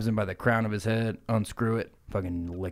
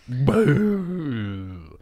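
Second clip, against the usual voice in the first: puffed exhale boo; 4 semitones higher, 5 dB louder than usual. Retold or imitated. Grimace exhales the smoke.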